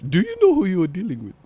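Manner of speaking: mock villain asking who you're dealing with; put-on menacing voice. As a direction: ham up a cartoon threat. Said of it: "why are you talking like that".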